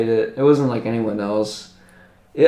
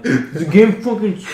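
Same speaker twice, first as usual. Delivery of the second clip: mock angry gamer; 7 semitones higher than usual. Mocking a raging gamer.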